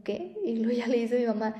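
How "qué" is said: high, startled little "¿Qué?"; the other mother's baffled squeak of disbelief; stunned "what?". Retold or imitated. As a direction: imitate someone caught off guard. Mother stunned by ghost story.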